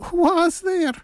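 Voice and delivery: very high put-on old lady call; wolf imitating an old granny; old lady's voice. A narrator's reading. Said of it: "put on his best old lady's voice".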